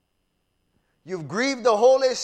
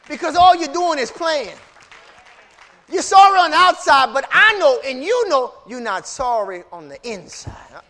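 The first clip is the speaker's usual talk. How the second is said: loud, raised preacher's accusation; forceful rebuke; 5 semitones higher, 12 dB louder than usual. Acted. Preacher voicing God to false penitents, forceful rebuke. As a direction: call out the pretence at full volume.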